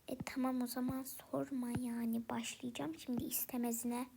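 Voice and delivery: annoyed muttered whisper; whispered grumble. Acted. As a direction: grumble it under your breath. Grumbling aside to herself. (sinirli fısıltı)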